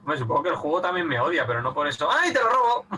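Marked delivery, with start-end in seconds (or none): 2.1-2.9 high teasing cry; playful squeal. Real, not acted. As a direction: squeal while stealing. Snatching a teammate's item.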